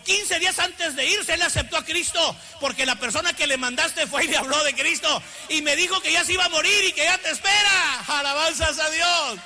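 high pulpit voice building to a shout; preaching. Real, not acted. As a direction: build the testimony into loud praise.

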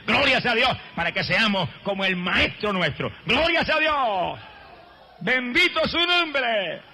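high, slow shouted praise; fervent drawn-out glory shouts; preaching praise. Acted. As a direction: cry out the praise to the congregation.

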